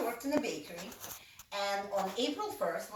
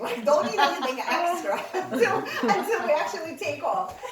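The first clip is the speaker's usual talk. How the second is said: laughing; 6 semitones higher, 8 dB louder than usual. Retold or imitated. Warning mom, laughing.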